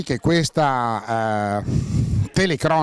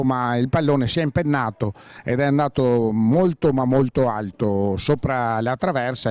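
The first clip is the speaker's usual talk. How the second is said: ordinary delivery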